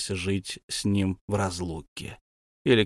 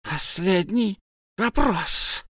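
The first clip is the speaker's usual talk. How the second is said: high, slow creature echo; Gollum repeating; 8 semitones higher, much slower than usual. A narrator's reading. Gollum repeats Bilbo's words slowly. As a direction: repeat it suspiciously. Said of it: "повторил Голум".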